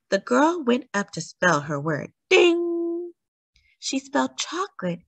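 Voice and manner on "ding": sung-out bell ding; a high held ding like a bell. A narrator's reading. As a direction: imitate a bell ringing. Imitating the bell.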